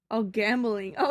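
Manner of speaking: ordinary talk, nothing marked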